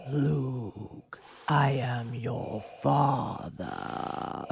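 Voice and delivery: slow, raspy, whispered villain line; menacing breathy Vader; Darth Vader voice. Pretend. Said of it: "how about you do, uh, Darth Vader?".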